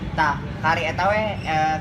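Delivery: ordinary delivery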